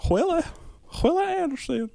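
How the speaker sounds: falsetto name-calling; silly high voice. Acted.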